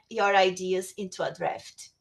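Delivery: usual speaking voice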